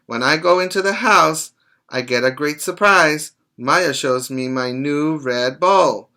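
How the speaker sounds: lively story reading. Acted. Teacher reading the new bowl scene to children, lively read-aloud. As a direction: tell the dog's happy surprise to the kids.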